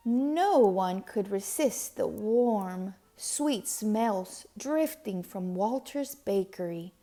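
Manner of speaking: plain reading voice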